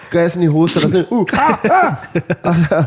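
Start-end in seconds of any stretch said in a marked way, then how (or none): 1.2-1.9 mock pained cry. Dad's mock reaction to scream.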